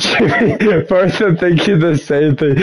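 fighting back a laugh while talking. Real, not acted. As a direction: giggle through the line. Laughing at friend's remark.

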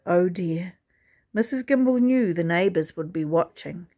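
ordinary delivery, nothing marked

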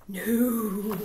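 drawn-out crowd protest; mild mock yell. A narrator's reading. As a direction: protest as one crowd, not too loud. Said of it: "yelled the crowd".

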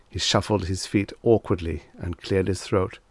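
ordinary delivery

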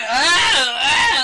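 high, loud flailing yells; hammed-up cries of being attacked; imitated panicked "ah"; mock screams. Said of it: "he's sitting there going".

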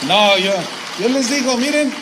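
shouting to the congregation; loud preaching burst. Real, not acted. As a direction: belt it out from the pulpit. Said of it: "preacher exclaims at full force". (exclamación a gritos desde el púlpito)

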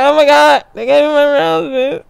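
high, hammed-up overwhelmed cry; mock tearful joy. Winner repeating thanks for roses, mock tearful. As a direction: play it choked up with fake happy tears. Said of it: "gonna have tears coming out my eyes".

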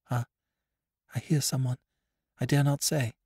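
lightly voiced young woman hushing; a young woman breaking off, afraid of being heard; startled young woman. A narrator's reading. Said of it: "She was gone again".

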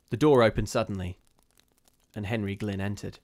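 ordinary delivery, nothing marked

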